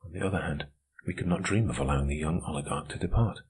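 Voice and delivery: plain reading voice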